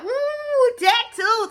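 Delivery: high-pitched thrilled "ooh"; gushing, re-enacted excitement; excited delight. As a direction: squeal with delight at the idea. Acts out delight at getting tattoos.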